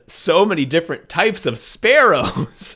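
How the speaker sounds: high, loud gushing wonder; hammed-up delight; mock excitement. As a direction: gush as if thrilled by dull birds. Acting character's wild time abroad.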